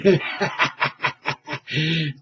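a soft rolling laugh after the cough; low chuckle. Real, not acted. Host laughing at his strong coffee, soft chuckle. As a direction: laugh at yourself, softly.

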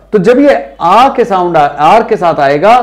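ordinary talk

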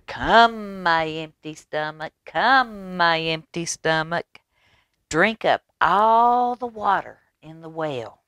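sing-song rooster commanding his stomach; rooster calling. Acted. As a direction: call it out like a proud little rooster. Rooster's magic call.

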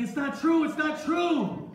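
loud, high cry of denial; desperate protest. Acted. Joseph held by guards, desperately protesting. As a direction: shout your innocence, stretching the last word.

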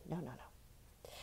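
soft unvoiced no, slow; whispered no. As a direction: breathe the no's out softly. Correcting herself while drawing.

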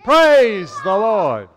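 loud, slow shout of praise; group praise. Real, not acted. Children and pastor shouting praise on the count. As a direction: call it out together. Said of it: "One, two, three".

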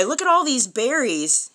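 ordinary delivery

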